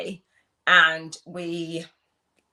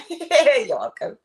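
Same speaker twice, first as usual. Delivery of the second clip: laughing; 9 semitones higher, 6 dB louder, much slower than usual. Real, not acted. Playful reply to comment.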